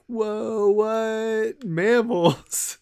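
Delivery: high, squeaky surprised voice; mock shock. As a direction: gasp it out in high disbelief. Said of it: "it's just like".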